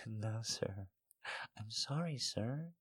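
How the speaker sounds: tiny, very soft apology; small voice. A narrator's reading. Woman apologising to her lover, in a small voice. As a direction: shrink the voice to almost nothing. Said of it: "I say with a small voice".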